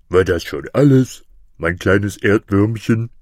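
loud monster bird voice; big, mocking creature; condescending griffin. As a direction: loom over the little one, drawing out the pet name. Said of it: "rief der Vogel Greif".

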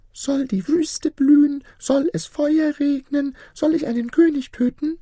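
eerie high chirp of a ring spirit. A narrator's reading. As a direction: chirp it out like a tiny beetle spirit. Ring spirit offers wishes.

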